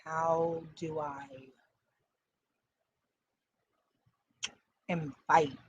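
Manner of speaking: talking while munching chips; crunching chips. Real, not acted. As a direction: mouth full of chips. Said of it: "Sorry, I'm eating chips".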